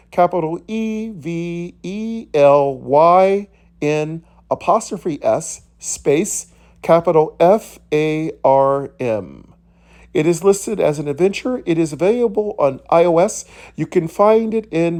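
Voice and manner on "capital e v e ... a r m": slow, deliberate letter-by-letter spelling. Real, not acted. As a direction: spell it out slowly for listeners, each letter separate. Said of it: "which is spelled".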